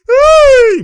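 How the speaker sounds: long high, loud "hey"; drawn-out high-pitched cry; excited whoop. Real, not acted. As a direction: holler as the player blows by. Reacting to a blow by.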